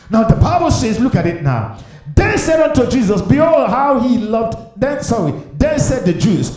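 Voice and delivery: high, loud pulpit demand; preacher's ringing high shout; full-force preaching. Acted. Declaims scripture at full force.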